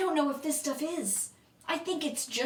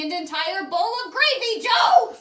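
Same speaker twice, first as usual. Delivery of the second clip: exasperated shout; 5 semitones higher, 7 dB louder than usual. Acted. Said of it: "Luca screams from".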